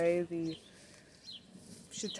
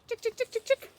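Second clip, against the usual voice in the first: high singsong chick chick call; calling chickens; faster than usual. Real, not acted. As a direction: call the hens in, high and bright.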